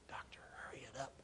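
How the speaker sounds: hushed, voiceless whisper of an impatient nurse; whispered nudge to hurry the doctor. Pretend. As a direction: breathe it as a nurse's urgent aside. Nurse's imagined impatient aside.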